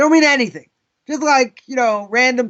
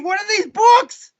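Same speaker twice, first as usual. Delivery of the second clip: terrified scream; 9 semitones higher than usual. Retold or imitated. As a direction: scream it out in terror. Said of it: "He's screaming".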